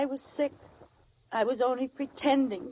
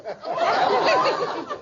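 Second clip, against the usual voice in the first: high delighted burst of laughter; 9 semitones higher than usual. Acted. Wife laughing at the mink coat gift. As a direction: laugh in disbelief.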